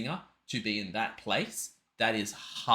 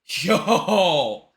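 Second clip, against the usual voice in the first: high, stretched-out yo, getting louder; amazed; 5 semitones higher, 6 dB louder, much slower than usual. Real, not acted. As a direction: react blown away, building to a loud yo. Stunned reaction to the singing.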